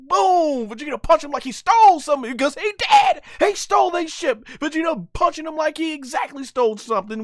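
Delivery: high, excited fight commentary; live play-by-play. Acted. Fan narrating an anime fight to viewers, hyped play-by-play. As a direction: call the action like a ringside announcer.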